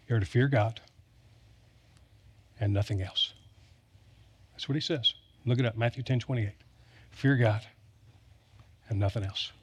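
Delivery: soft, earnest and low; hushed earnest emphasis; quiet conviction. Real, not acted. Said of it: "emphatic quiet repetition".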